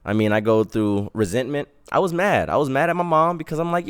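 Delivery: normal talking voice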